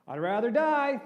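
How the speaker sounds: high, loud despairing cry; petulant shout. Retold or imitated. Preacher voicing Jonah crying he'd rather die. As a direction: throw it out like a sulking child. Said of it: "And Jonah's saying".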